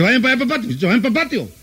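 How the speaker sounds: a flustered mother, pitch shooting up as she hurries them away; nervous wife hurrying the children out to the patio, high and frantic; nervous shooing. Acted. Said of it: "Y ella de los más nerviosos dice".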